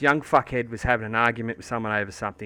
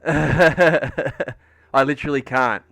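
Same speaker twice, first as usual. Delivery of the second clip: laughing; 2 semitones higher, 7 dB louder, much slower than usual. Real, not acted. Laughing at his weak hand.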